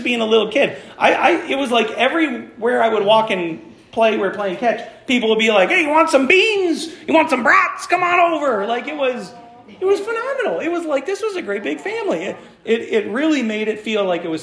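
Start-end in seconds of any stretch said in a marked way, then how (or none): 5.7-8.7 high-pitched, eager calling out; friendly tailgater call. Retold or imitated. Tailgaters inviting a little kid to eat, calling out. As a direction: imitate fans hollering an offer of food.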